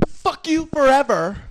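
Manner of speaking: high, slow mock fury; spurned woman. Woman ending a breakup on bad terms, mock-furious. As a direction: draw it out high like a bitter ex. Imitates ladies ending a relationship.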